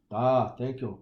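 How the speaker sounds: put-on a deliberately awful Russian accent for a thank-you; bad Russian accent. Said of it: "That was a horrible Russian accent".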